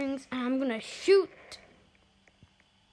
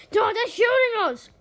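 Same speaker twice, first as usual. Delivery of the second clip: high, loud warning; alarmed yell; 8 semitones higher, 6 dB louder than usual. Real, not acted. Player warning team of shooting, alarmed yell. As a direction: cry out under fire. Team under fire in game.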